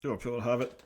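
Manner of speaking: usual speaking voice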